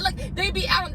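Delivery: normal talking voice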